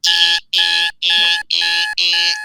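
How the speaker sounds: alarm beeping. Retold or imitated. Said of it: "blazing sound of".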